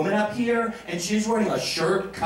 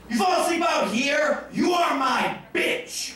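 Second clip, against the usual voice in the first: high, drawn-out playful shout; hammed-up threatening holler; mock threat; 9 semitones higher, slower than usual. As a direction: shout a comic warning, much higher and slower. Playful threat to volunteers on stage.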